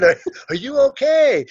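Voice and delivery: high, exaggerated questioning; high-pitched checking. He asks the fallen man, high and exaggerated.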